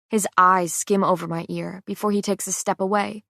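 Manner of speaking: ordinary delivery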